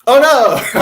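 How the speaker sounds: real loud outburst of dismay; startled cry. Real, not acted. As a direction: cry out at an accidental slip. Accident with the back button.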